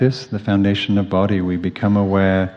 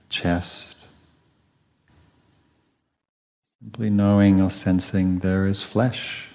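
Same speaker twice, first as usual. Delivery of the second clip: quiet, spacious body-scan voice with long pauses; hushed, even meditation cues, falling away softly; guided meditation; 4 dB quieter than usual. Real, not acted.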